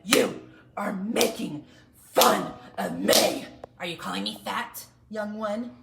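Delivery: slow loud menacing scold; angry teacher. Retold or imitated. The teacher with her paddle scolds a child, loud and slow. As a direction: threaten slowly and loudly. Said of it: "she got her paddle and she said".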